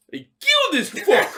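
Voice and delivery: high, hammed-up Russian brute; mock Drago boast; mock Russian accent. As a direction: bellow it like a cartoon Russian boxer. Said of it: "Dolph Lundgren be just be like".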